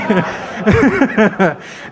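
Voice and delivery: high burst of laughter; can't hold the laugh in; real laughter. Real, not acted. Speaker recalling the stunned stranger, high laughter. Laughing at own prank story.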